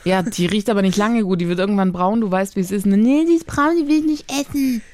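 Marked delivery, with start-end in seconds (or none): 2.9-4.8 put-on whiny child voice. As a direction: play a picky little kid. Mother voices fussy child.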